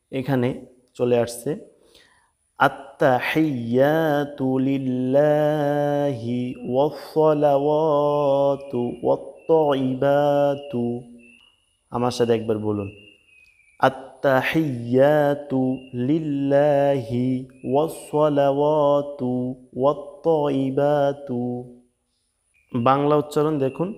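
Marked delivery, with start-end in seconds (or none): 2.6-10.8 long drawn-out syllables, measured recitation; slow Quranic recitation. Shown as a demonstration. 13.8-21.4 long drawn-out syllables, measured recitation; slow Quranic recitation. Shown as a demonstration.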